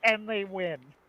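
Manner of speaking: high, emphatic announcer voice; exasperated mock commentator. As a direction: call it like an incredulous match commentator. Acts sketch's sports commentators.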